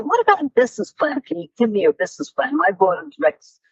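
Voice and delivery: a pushy visitor rushing his request; fast pushy businessman. Retold or imitated. The visiting publisher pressing for a business plan, fast and pushy. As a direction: rattle it off quickly, pressing for a plan. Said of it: "He said".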